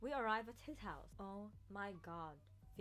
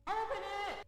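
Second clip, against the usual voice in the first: high, loud demand; hammed-up excited yell; impatient scream; 12 semitones higher, 7 dB louder than usual. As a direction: shriek to be let in.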